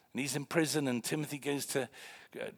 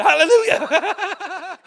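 very high, very loud, drawn-out yelp; startled shout; 14 dB louder, much slower than usual. Retold or imitated. Preacher shocked as boy wakes up, startled shout. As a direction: shriek it back, breaking into laughter.

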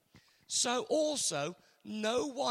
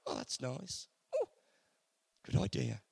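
bored shrug; 7 semitones lower, 5 dB quieter than usual. Pretend. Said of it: "and I go".

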